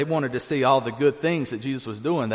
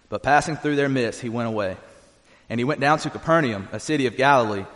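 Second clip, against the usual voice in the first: usual speaking voice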